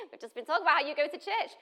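fast, high and laughing; quick laughing disbelief; laughing retort. Retold or imitated. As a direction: push it out through laughter. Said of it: "I was like".

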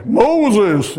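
loud booming call of God; loud calling voice from the burning bush. Retold or imitated.

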